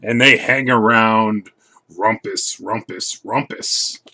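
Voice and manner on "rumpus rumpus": ordinary delivery, nothing marked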